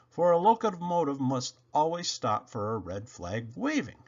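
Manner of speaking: usual speaking voice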